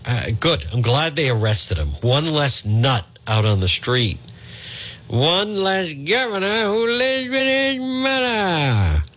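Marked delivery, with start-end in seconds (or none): 5.1-9.0 high, slow, sneering singsong; mocking singsong. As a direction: drag it out to jab the governor. Running mocking gag on governor.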